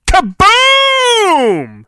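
big high shouted kaboom; explosion shout. Acted. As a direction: yell it like a cartoon blast. Storybook explosion acted out.

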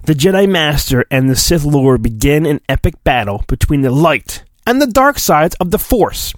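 ordinary talk

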